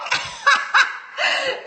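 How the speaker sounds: real high burst of laughter; gleeful laugh. Real, not acted. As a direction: laugh out high after blocking a troll. Laughs after blocking a troll.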